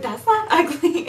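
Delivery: laughing through the words, high and loud. Retold or imitated. Cameron laughing at the failed ugly picture. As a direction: protest while cracking up. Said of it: "he was like".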